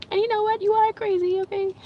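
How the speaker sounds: high, squeaky teasing voice; playful tease. Pretend. Teasing viewers that they're crazy.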